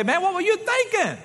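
high, exasperated "what were you thinking"; exasperated outburst. Retold or imitated. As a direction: blurt it out in disbelief. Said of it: "everything in me wants to say".

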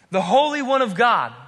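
loud, high cry of recognition; alarmed demon. Acted. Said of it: "the demon can't help but go".

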